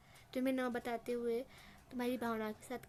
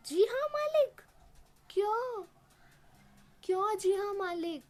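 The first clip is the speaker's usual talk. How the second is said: much higher, louder outraged shriek; explosive angry echo; 7 semitones higher, 4 dB louder than usual. A narrator's reading. Landlord mocking Julia's meek reply, outraged high shout. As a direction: fling her words back at her in fury. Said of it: "एकदम गुस्से से भड़क जाता है".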